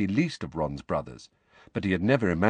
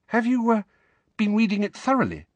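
put-on much higher, halting girl's voice; anxiously; 3 dB louder, faster than usual. A narrator's reading. Hermione asks Harry anxiously, high and halting. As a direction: Hermione treading carefully. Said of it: "still more anxiously".

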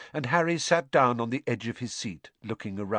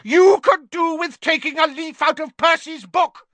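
much higher, loud furious yell; 10 dB louder than usual. A narrator's reading. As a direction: yell it straight at him. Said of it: "yelled Missus Weasley".

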